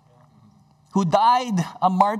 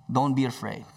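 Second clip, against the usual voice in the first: calm, assuring; 5 semitones lower than usual. Retold or imitated. Jesus to disciples in the storm, calm and assuring. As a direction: settle them gently, voice lowered. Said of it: "a calm, assuring voice".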